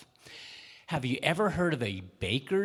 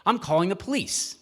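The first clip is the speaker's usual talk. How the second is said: loud threat; 6 semitones higher, 5 dB louder than usual. Acted. Baker threatens the man.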